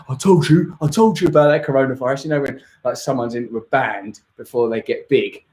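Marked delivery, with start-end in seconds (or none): 0.1-0.8 smug. Retold or imitated. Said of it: "he'll be so smug about it and so like". 0.8-2.2 smug. Retold or imitated. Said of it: "he'll be so smug about it and so like".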